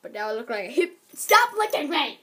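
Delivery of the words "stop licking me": higher, louder, slow protest; real yelp at the dog. Real, not acted. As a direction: shout it at the licking dog. Dog licking her face.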